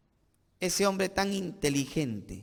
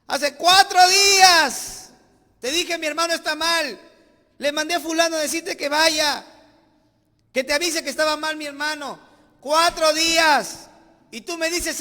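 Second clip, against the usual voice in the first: Marta's wailing complaint; 9 semitones higher, 9 dB louder than usual. Retold or imitated. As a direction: shout Marta's grief at the Lord. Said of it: "vino Marta llorando".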